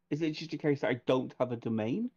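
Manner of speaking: ordinary delivery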